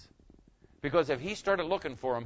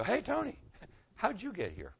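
high, soft hail to a friend; wondering greeting; 8 semitones higher, 8 dB quieter than usual. Acted. Preacher passing Tony in heaven, wondering. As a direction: call softly with lifted, curious pitch.

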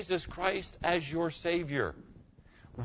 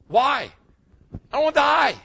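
emphatic cry; 9 semitones higher, 8 dB louder than usual. Real, not acted. Emphatic point to congregation.

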